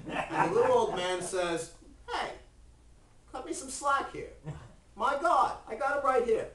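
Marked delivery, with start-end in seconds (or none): 2.1-6.5 put-on high-pitched, louder old man standing his ground; protesting old man. Old man defending his God to Abraham, protesting. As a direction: plead a case, voice pitched up.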